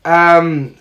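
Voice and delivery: usual speaking voice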